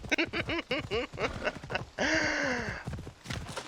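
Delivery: a high, breathy burst of laughter. Real, not acted. Laughing at his own joke, a high laugh.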